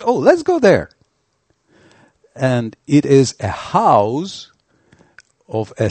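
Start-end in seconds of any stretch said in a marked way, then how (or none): 0.0-0.9 much higher and louder, eager lark; suddenly spot the hut and pitch the idea brightly upward; playful idea. Acted. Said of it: "and he says".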